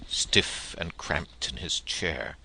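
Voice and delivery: usual speaking voice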